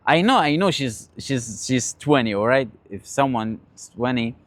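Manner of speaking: ordinary talk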